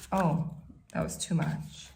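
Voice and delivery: ordinary talk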